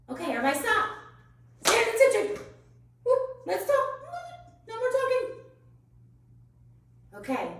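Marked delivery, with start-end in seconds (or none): 1.7-5.4 calling class to order. Real, not acted. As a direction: pitch up sharply to get attention. Teacher stopping noisy class.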